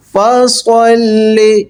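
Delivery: فَصَلِّ with a thick, heavy saad, drawn out. Shown as a demonstration. Teacher models فَصَلِّ with heavy saad for students. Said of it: "سواد کی جگہ سین اکثر پڑھ دیتے ہیں".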